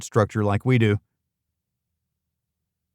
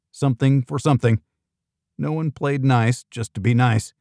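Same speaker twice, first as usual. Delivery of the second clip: ordinary delivery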